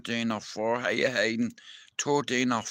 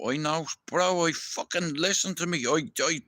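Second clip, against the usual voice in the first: ordinary talk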